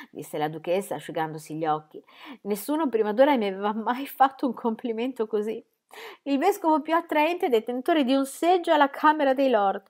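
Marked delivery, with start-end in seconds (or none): 2.4-9.8 higher, lightly mocking duchess voice; a teasing old duchess, amused. A narrator's reading. The duchess teasing the bishop, amused.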